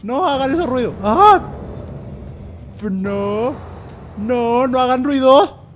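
high, loud, anxious protest; nervous pleading. Real, not acted. Player begging whoever makes noises to stop, high and anxious.

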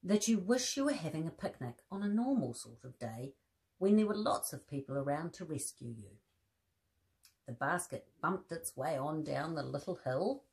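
gentle storybook reading with voiced bears; reading to children. A narrator's reading. Presenter reading a teddy bear story to children, gentle storybook voice. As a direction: read the story aloud to little listeners.

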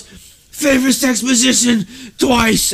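high and slow, drawn out; mock delirious repeat. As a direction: say it again like a dazed patient. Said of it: "He goes".

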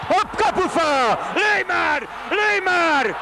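high, loud desperate yell; excited hungarian sports commentary. Real, not acted. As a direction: scream at the player to shoot. Hit the post, begging shot.

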